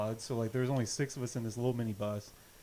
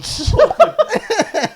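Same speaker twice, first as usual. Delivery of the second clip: high, loud laugh; burst of laughter; 16 dB louder than usual. Real, not acted. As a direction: crack up hard. Laughs at own mishap.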